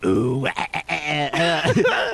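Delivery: stuttered ooh-wah sample; [name] ooh wah. Shown as a demonstration. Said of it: "You do yours".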